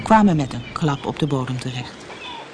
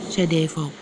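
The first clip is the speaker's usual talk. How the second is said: plain reading voice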